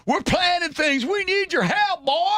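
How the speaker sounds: very high mock-Southern holler; Southern accent. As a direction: badger him as a cartoon Southern reenactor. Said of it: "You can do a Southern accent".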